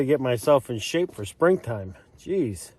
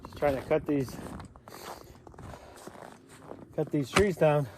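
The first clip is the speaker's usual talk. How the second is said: out of breath; 8 dB quieter than usual. Real, not acted. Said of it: "I am so out of breath".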